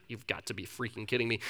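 low, quick grumble under the breath; muttered disbelief. Acted. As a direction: mutter it while walking off, acted. Servant walks away grumbling to himself.

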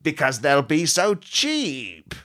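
very slow, drawn-out evil gloat; slow villain gloat. Acted. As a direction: relish the words like a cartoon baddie.

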